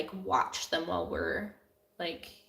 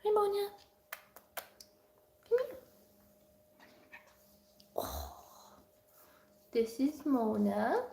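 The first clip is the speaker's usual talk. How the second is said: high, sing-song hello to the cat; 5 semitones higher, 3 dB quieter than usual. Real, not acted. Owner greeting her cat Mona in a high coo.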